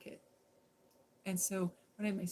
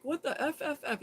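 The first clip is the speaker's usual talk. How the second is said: laughing mimic; 7 semitones higher, 3 dB louder than usual. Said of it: "You know, you know".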